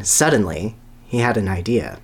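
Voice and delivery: ordinary talk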